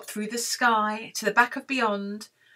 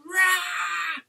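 high mock roar; dragon roar; 7 semitones higher than usual. Storyteller shows dragon to children, mock roar.

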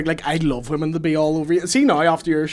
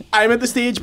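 ordinary talk, nothing marked

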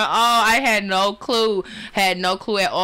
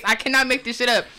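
ordinary talk, nothing marked

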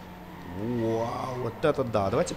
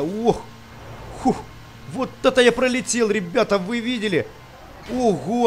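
excited; 7 semitones higher, 4 dB louder than usual. Real, not acted. Flying over a jump.

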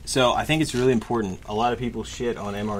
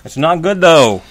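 loud; 5 semitones higher, 12 dB louder, slower than usual. Real, not acted. Emphatic verdict on the taste.

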